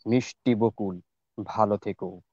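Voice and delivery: slow, measured verse with drawn-out line endings and long pauses; poem recitation. Shown as a demonstration. Reciter offers a famous farewell poem to the gathering, slow measured recitation. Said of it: "চেষ্টা করছি আবৃত্তি করার".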